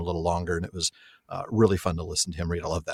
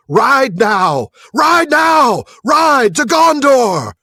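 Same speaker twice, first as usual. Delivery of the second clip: high, loud charge cry; king calling his host to ride, shouting; battle shout; 10 dB louder, much slower than usual. Acted. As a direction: roar the charge.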